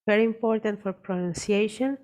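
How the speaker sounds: normal talking voice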